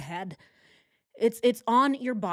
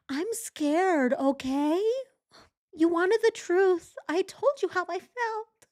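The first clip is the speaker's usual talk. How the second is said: high, fake-crying whine imitating Chris; much higher mock sob, hammed-up; mock whiny victim; 11 semitones higher than usual. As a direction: whimper it like a self-pitying victim. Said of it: "Oh, victim, victim".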